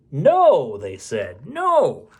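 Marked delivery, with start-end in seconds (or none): 0.1-0.8 firm no. Acted. Refusal in dialogue. 1.4-2.1 high, put-on squeaky no; a silly voice saying no; high mock refusal.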